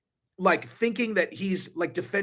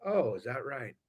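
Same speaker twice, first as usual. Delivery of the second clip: low, much softer, icy and sarcastic; sarcastic Jada; 6 semitones lower, 10 dB quieter than usual. Retold or imitated. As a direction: mutter it under your breath, unimpressed. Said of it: "you see Jada's like".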